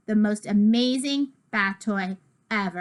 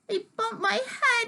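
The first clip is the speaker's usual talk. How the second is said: crying out; 12 semitones higher than usual. Acted. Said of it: "he cries out".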